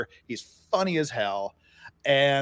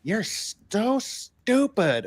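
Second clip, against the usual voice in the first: very slow, high, drawn-out put-down; a slow, high, teasing bartender voice; drawn-out teasing friend; 7 semitones higher, much slower than usual. Retold or imitated. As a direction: stretch the insult out slowly to tease. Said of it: "He said".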